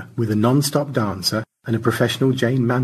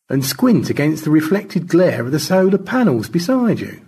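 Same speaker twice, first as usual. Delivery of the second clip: higher character voice, dawning realisation; George, puzzling it out; 7 semitones higher, 4 dB louder than usual. Acted. Astronaut describing the view to his drifting crewmate, dawning realisation. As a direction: lift the voice as George works it out.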